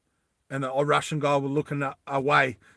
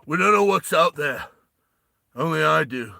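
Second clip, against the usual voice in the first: loud, higher, forceful tough-guy leader; Sylvester Stallone; 5 semitones higher, 6 dB louder than usual. Acted. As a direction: bark orders as an action-movie hero. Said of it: "that's not Sylvester Stallone".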